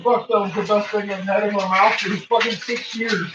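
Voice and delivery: high put-on dog voice; voicing the dog Chico. Owner voices Chico after tasting a treat, high put-on voice. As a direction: give the dog a gleeful voice. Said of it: "He's like".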